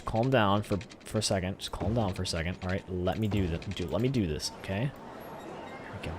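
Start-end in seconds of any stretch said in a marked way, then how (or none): 4.9-6.1 soft, slow whisper of relief; relieved whisper. Real, not acted. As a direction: breathe it out quietly. Road finally connects.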